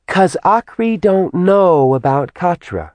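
put-on high, chattering little girl voice; childlike demon voice. A narrator's reading. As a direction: tattle on her like a child. Simi character voice.

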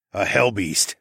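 ordinary delivery, nothing marked

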